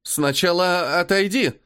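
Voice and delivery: hesitant, slow repeat; unsure. Acted. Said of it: "неуверенно повторяет".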